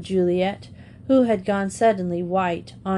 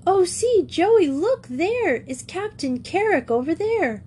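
excited exclamation; 9 semitones higher than usual. A narrator's reading. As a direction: call out to your friend across the station. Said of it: "Her clear accents carried high above".

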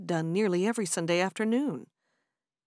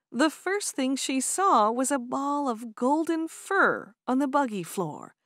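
ordinary delivery, nothing marked

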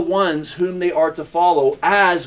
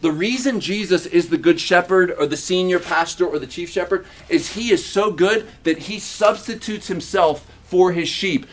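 ordinary talk, nothing marked

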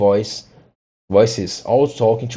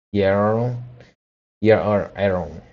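ordinary delivery

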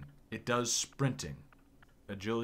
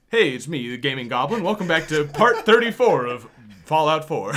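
loud, bright hammed-up YouTuber greeting; mock YouTuber intro; 4 semitones higher, 11 dB louder than usual. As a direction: ham it up like a channel host opening a video.

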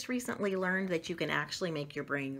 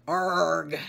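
pirate growl; 3 semitones lower, 4 dB louder than usual. Acted. Pirate book mention.

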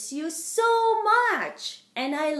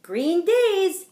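plain reading voice

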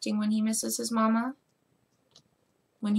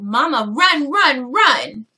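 retold loud call; 2 semitones higher, 11 dB louder, slower than usual. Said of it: "so he said".

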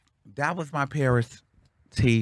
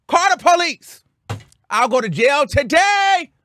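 loud defiance; 6 dB louder than usual. Retold or imitated. Daring the restaurant to call police, loud. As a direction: yell the dare, stretching it out. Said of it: "Now I'm, now I'm loud".

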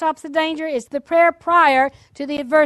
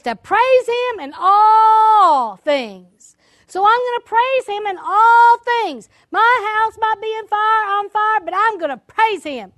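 high, stretched-out exultant declaration; retold joyful praise, hammed up; imitated jubilant husband; 6 semitones higher, 4 dB louder, slower than usual. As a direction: proclaim it elatedly, drawing out the key words. Voices husband rejoicing while house burns.

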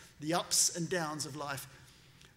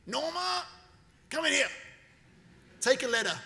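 high, loud summons for the assistant; commanding call; 9 semitones higher, 4 dB louder than usual. Retold or imitated. Trump angrily summoning his assistant Norma to dictate a letter, loud call. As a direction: bark for your assistant across the office.

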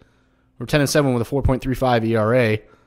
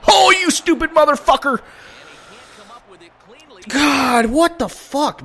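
high-pitched cursing in frustration; real angry outburst; 12 semitones higher, 3 dB louder than usual. Real, not acted. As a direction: curse at the game, voice shooting up. Curses after a bad play.

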